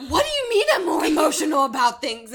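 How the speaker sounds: high, loud, offended retort; indignant outburst. Retold or imitated. Retelling her outraged reply to her boyfriend, high and loud. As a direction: fire back as if insulted. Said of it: "was like".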